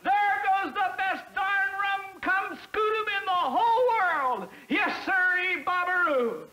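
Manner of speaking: over-the-top enthusiastic yell; excited shout. Pretend. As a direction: burst out cheering for the truck. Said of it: "I want to shout".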